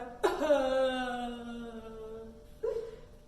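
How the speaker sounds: sung fake sob; hammed-up wail down to the toes; playful pretend crying; mock crying. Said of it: "reach your hands up and cry".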